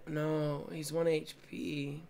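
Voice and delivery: soft, deflated mock mumble; quietly mimicking a crushed player; imitating a defeated teammate. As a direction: copy how beaten he sounded, dropping away. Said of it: "He just sounds so defeated".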